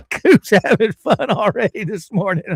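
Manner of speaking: high, loud words bubbling out of real laughter; laughing while talking. Real, not acted. Host having fun greeting the morning chat, laughing as he talks. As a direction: talk through a burst of laughter.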